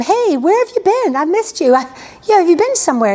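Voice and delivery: high, sing-song, knowing questions; Elisha probing. Retold or imitated. Master quizzing his servant, in a high, knowing sing-song. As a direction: teasingly fake innocent. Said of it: "Then Elisha says to him".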